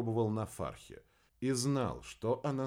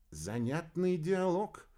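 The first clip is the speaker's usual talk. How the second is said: wry remark; 9 semitones higher, slower than usual. Acted. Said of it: "прокомментировал Валентин".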